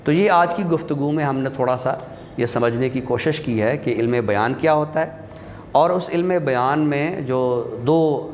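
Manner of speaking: ordinary delivery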